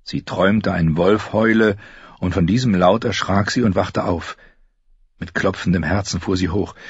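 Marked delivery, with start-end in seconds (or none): none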